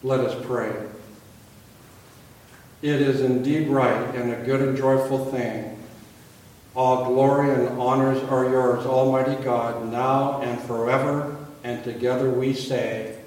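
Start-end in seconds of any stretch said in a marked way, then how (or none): 0.0-11.3 liturgical call. Acted. Leads liturgical responsive reading.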